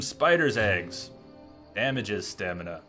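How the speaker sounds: usual speaking voice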